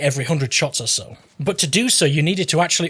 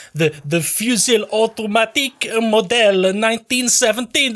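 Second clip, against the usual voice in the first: hammed-up Italian accent, higher; slow, showy Italian accent; mock Italian accent; 6 semitones higher than usual. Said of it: "This is Italian".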